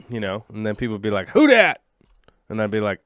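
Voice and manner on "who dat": loud goofy call. Pretend. People asking, acted quote.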